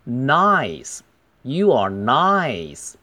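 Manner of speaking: NICE with a wide, drawn-out "eye" sound; full diphthong. Shown as a demonstration. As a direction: open wide and stretch the I in NICE. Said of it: "双元音". (NICE 的I读足时长，大嘴双元音)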